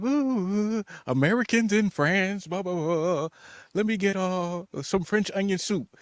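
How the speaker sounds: hammed-up wide-eyed excitement; mock excited tourist. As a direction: act out a giddy tourist abroad. Acting out his eager tourist self.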